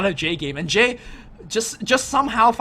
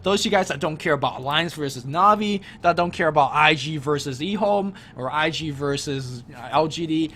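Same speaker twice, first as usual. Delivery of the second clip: ordinary talk, nothing marked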